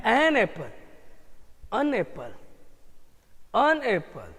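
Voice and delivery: an apple said softly and easily, the an falling and unstressed; relaxed an apple. Shown as a demonstration. Teacher shows the class the relaxed an apple. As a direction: ease into an apple without any push. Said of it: "कितना आराम से मजा से बोल पा रहे हैं".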